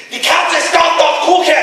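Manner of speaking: high, loud barked German; mock menacing German shout; threatening German. Shown as a demonstration. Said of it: "which is".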